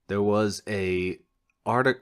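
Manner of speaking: ordinary talk, nothing marked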